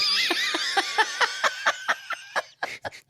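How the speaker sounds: high-pitched squealing laugh. Real, not acted. Huge laugh.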